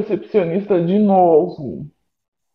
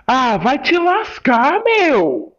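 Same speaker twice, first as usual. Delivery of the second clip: higher, louder furious rant at the door; angry yelling; 7 semitones higher, 3 dB louder than usual. Acted. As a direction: yell at whoever keeps knocking. Gritando com quem bate à porta. (gritando furioso com quem bate na porta)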